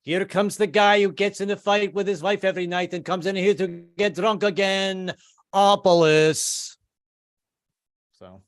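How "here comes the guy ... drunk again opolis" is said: high, louder sneering tease, slowing at the end; high mock jeer; mocking Greek waiter. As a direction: taunt him as a regular drunk. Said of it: "they're like".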